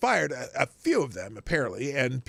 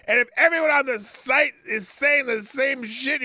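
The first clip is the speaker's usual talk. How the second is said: loud, high-pitched ranting; worked-up rant; 10 semitones higher, 5 dB louder than usual. Real, not acted. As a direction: get fired up and rant. Continued rant.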